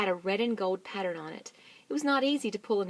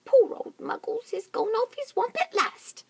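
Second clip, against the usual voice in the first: very high mock pity; teasing bird; 7 dB louder than usual. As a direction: cluck over poor Muggles. Roly-poly bird teasing.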